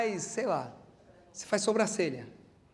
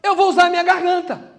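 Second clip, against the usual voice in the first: higher and louder, resolute; determined cry; 7 semitones higher, 8 dB louder than usual. Acted. Blind Bartimaeus resolving to shout for Jesus, loud. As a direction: declare it at full voice.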